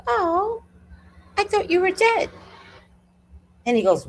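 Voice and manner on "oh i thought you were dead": mock surprise. To the neighbour gone two days, high mock astonishment. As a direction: act it out way up high. Acted jab at absent neighbour.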